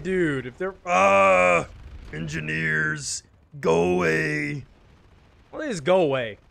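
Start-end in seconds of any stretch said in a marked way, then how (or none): 0.5-4.6 slow drawl. Real, not acted. Slowed drawn-out aside.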